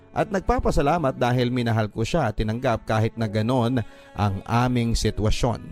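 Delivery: ordinary delivery, nothing marked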